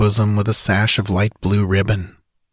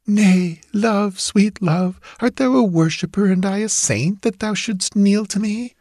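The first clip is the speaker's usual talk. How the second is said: put-on tender ghost girl; 11 semitones higher than usual. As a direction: coax him gently, lovingly. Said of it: "she asked him tenderly".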